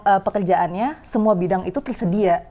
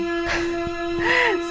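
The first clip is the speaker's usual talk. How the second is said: high laugh; 11 semitones higher, 3 dB quieter than usual. Real, not acted. Laughs at bird interrupting.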